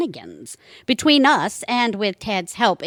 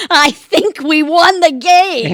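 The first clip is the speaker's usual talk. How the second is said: louder, higher victorious cheer; gleeful triumph; 9 semitones higher, 9 dB louder than usual. Acted. Annie gloating after the villain is caught. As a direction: crow about the win.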